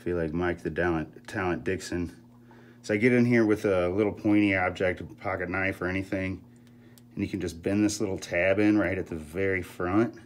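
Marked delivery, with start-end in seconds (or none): none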